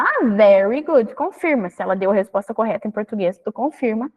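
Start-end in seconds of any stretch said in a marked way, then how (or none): 0.0-1.1 slow, encouraging very good; slow praise. Shown as a demonstration. Teacher praising the child slowly. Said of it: "tu fala".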